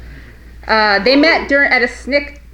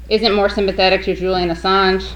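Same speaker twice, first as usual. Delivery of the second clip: ordinary delivery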